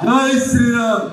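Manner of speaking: drawn-out rallying call; slogan shout. Real, not acted. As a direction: lead the crowd in a slow slogan. Leading crowd in slogan. (నినాదం సాగదీసి అరవడం)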